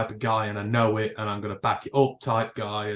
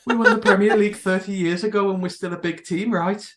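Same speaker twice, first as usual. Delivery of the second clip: higher, louder Scouse voice through a laugh; mock Scouse fan; 8 semitones higher, 5 dB louder than usual. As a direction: needle rival fans in Scouse. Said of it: "mocking a club fan".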